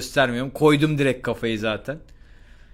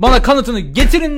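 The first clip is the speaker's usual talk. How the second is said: high, loud staged outrage; fake anger; 9 semitones higher, 8 dB louder than usual. Student to principal, theatrically angry. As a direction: play up the indignation. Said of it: "fake sinirleniş".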